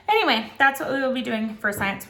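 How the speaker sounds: ordinary talk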